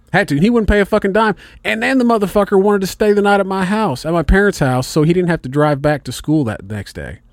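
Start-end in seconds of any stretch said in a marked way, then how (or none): none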